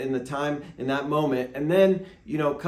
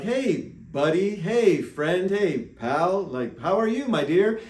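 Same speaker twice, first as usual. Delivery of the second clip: bright, high, overly friendly hellos; hammed-up friendly greeting; cheery fake greeting; 4 semitones higher, 3 dB louder, slower than usual. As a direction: cover a forgotten name with big cheer. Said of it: "I'm like".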